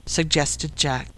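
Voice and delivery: ordinary delivery, nothing marked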